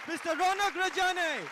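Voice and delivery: loud, high announcer call; announcing the act. Real, not acted. Host to crowd, introducing comic, loud announcement. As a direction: call the name out big.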